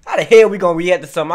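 loud, fast exasperated question; frustrated outburst. Real, not acted. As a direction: blurt it out in disbelief. Frustrated rant about mask rapper.

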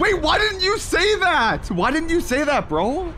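high-pitched, exasperated protest; incredulous. Real, not acted. Mock outrage at teammate's late confession.

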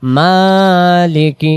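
মালিকি drawn out very slowly, each syllable held; slow drawn-out recitation. Shown as a demonstration. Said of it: "আবার". (ধীরে টেনে টেনে তিলাওয়াত)

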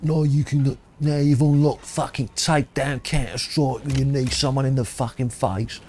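put-on Cockney accent, drawn out like a London boxing trainer. Gamer mimicking the game's London trainer in a Cockney accent. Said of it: "That was, like, super London accent".

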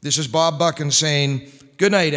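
ordinary talk, nothing marked

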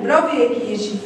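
ordinary delivery

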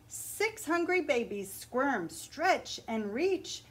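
put-on lively picture-book read-aloud for children; story narration. A narrator's reading. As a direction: read it like story time at the library.

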